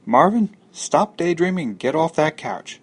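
loud, high-pitched nag; nagging scolding. Acted. Someone ordering Marvin off the couch, nagging loudly. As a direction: break into his daydream with a sharp order.

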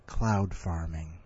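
low, slow and pondering; thoughtful. Shown as a demonstration. Host shows his story's ending, low, slow and musing. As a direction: savour it like a deep thought. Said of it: "in a very thoughtful way".

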